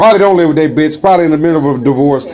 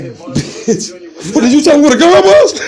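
shocked high yell; 10 semitones higher than usual. Retold or imitated. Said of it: "You like".

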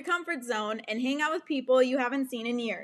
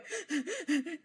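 nervous high laugh; 7 semitones higher, 6 dB quieter than usual. Real, not acted. Nervous laugh during anxiety.

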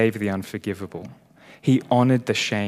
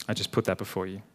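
ordinary talk

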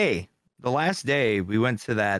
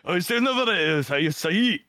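higher mock anime delivery; 5 semitones higher than usual. Host playing anime character to laughing friends, hammed-up voice. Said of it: "like you're speaking like in an anime".

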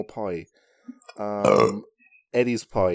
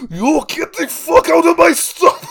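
very high, loud, slow Chinese caricature; screechy mock rage in Chinese accent; South Park restaurant owner; 8 dB louder, slower than usual. Cartoon owner throws someone out, shouted Chinese accent. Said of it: "the voices from South Park".

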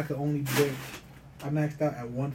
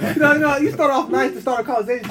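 loud, high and fired up; hyped sports story, loud and high; excited; 9 semitones higher, 8 dB louder than usual. Real, not acted. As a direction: pump it up while explaining. Excited explaining trash talk.

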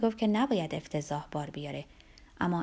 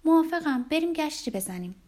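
bright glad burst, higher and louder on the first word; happily agree, eager to go; cheerfully; 7 semitones higher, 4 dB louder than usual. A narrator's reading. Olga gladly accepts Jakub's walk, cheerful burst. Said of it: "با شادی گفت".

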